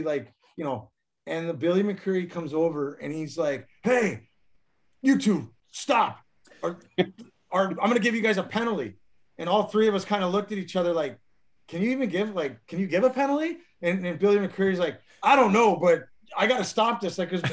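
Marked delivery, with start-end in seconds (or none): 3.8-9.0 higher, raised-voice imitation of a referee; retold referee's warning. Referee to two scuffling teammates, imitated stern shout. 11.7-13.6 higher-pitched questioning, rising at the end; retold puzzled disbelief. Players to referee about penalizing teammates, imitated incredulous question. 15.2-17.2 higher, louder burst easing off; retold flustered referee. Referee answering two brawling teammates, imitated flustered protest.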